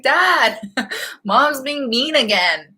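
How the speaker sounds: high, laughing, whiny complaint to dad; whiny tattling kid. Retold or imitated. Daughter whines to dad about mean mom, laughing and whiny. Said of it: "I was like".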